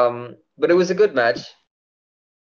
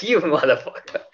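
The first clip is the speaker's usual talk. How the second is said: laughing, higher-pitched retold taunt; playful retold jeer, rising louder; mock taunt; 5 semitones higher, 3 dB louder than usual. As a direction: tease a friend through laughter, imitating yourself. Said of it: "I was like".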